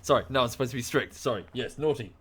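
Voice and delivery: ordinary talk, nothing marked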